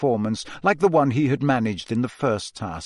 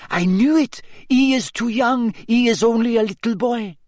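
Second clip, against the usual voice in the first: put-on French accent; 9 semitones higher than usual. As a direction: sneer it in a French accent, pitched up. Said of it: "Fleur Delacour saying".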